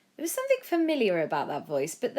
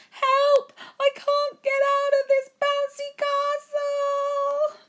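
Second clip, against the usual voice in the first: much higher, louder slow wailing cry for help; trapped voice; 8 dB louder, much slower than usual. A narrator's reading. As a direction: wail for help, stuck. Someone stuck calling for help.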